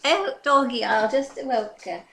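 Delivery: a fussy, gossipy English auntie character, put on throughout; chatty old English lady. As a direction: play a cheerful doting English granny.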